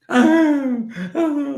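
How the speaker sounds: loud, very high mock bawling; imitated child's wailing. Son reminded of his sore head, mimicked wailing. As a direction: burst into a little kid's wail. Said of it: "starts wailing again like seven-year-olds".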